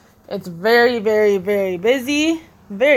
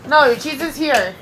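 usual speaking voice

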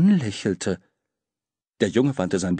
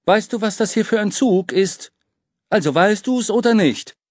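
drängelnder Besserwisser; 10 semitones higher, 4 dB louder than usual. A narrator's reading.

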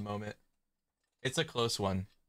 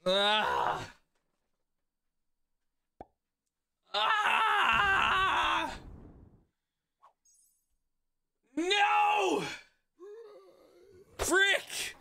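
panicking gamer; much slower than usual. Real, not acted. Zombies wiping out units.

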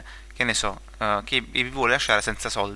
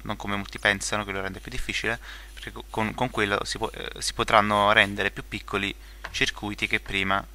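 usual speaking voice

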